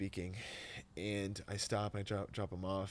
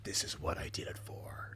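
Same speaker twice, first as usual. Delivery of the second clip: whispered resolve; 4 semitones lower than usual. Retold or imitated. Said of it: "I would be like".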